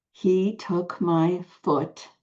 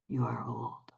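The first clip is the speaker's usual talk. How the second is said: whisper; 5 semitones lower, 10 dB quieter than usual. Shown as a demonstration. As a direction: chant fading to a whisper, lower. Last chant fades out.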